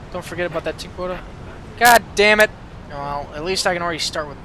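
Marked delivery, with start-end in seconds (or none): 1.8-2.5 louder, annoyed curse; frustrated outburst. Real, not acted. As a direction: vent at the tip quota. Frustrated parking cop.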